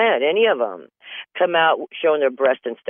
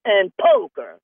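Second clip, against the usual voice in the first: father's voice; 9 semitones higher than usual. Retold or imitated. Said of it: "He says".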